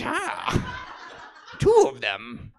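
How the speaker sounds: high, loud troll exclamation; hammed-up troll squeal. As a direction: play an excited comic troll. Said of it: "that's a troll voice".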